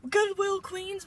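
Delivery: playful imitation of the older clerk; imitating the older lady. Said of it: "And she's just like".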